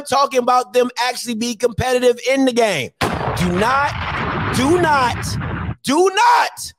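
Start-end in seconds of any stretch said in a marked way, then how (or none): 3.4-6.7 building to a shout. Real, not acted. Host warning callers off lame excuses, building to a shout. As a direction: hammer the warning harder each time.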